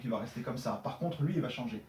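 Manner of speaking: ordinary talk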